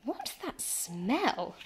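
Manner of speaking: slow, drawn-out, suspicious question; suspicious mum. Acted. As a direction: sniff the air and ask slowly. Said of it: "she asked, wrinkling her nose".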